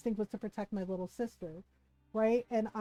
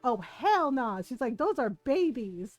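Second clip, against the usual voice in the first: higher, louder retold outrage; the neighbor's wife scolding the man, higher and louder in outrage; imitating outraged neighbor; 5 semitones higher, 5 dB louder than usual. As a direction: scold him like a furious neighbor lady.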